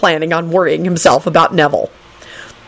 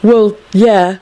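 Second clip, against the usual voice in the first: higher, exasperated reply; disgusted; 5 semitones higher, 4 dB louder than usual. A narrator's reading. Neville answers Dragomir's remark about Hagrid, disgusted. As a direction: answer with open distaste. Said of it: "Neville replied disgustedly".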